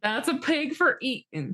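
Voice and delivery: lightly hammed country voice, high; folksy farmer echo. Echoing the farmer bit, mild country voice. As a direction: join the bit as a rustic farmer.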